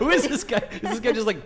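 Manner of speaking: high, amused disbelief; who even says that, laughing; incredulous. Real, not acted. Incredulous at the commenter.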